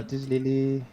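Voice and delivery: slurring a little, tipsy. Acted. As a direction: act a bit drunk. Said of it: "I'm trying to sound like I'm a bit tipsy".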